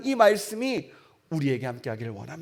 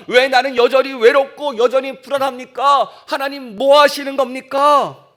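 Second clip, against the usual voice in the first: loud, higher, desperate plea to God; anguished prayer cry; 5 semitones higher, 11 dB louder than usual. Acted. Preacher voicing a lonely believer's prayer, loud anguished cry. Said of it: "이런 기도예요".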